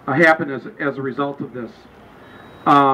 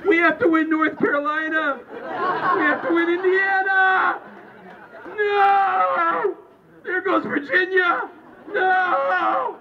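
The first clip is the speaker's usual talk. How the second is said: high, drawn-out slow-motion howl; slow-motion mock wail; 11 semitones higher, much slower than usual. Voter in the Matrix over the ballot, slow-motion wail. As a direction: stretch every word like a film's slow-motion scream. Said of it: "just in slow motion".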